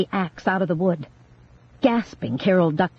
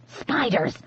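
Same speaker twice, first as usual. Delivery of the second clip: softer, steadier spiders; quiet insistent word; said again quietly; 4 semitones higher, 6 dB quieter than usual. A narrator's reading. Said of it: "Grace said again".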